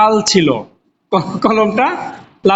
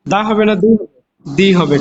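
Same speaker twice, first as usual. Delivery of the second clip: normal talking voice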